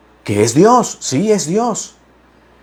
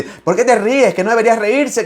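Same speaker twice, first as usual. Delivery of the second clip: mocking critics; 7 semitones higher than usual. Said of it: "critics scolding his laughter".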